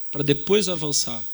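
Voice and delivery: normal talking voice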